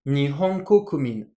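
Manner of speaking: Nihon Kokumin said slowly, pitch rising then falling; slow pitch demo. Shown as a demonstration. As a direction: spell out the rise and fall of Nihon Kokumin slowly. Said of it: "On monte ici, on descend ici".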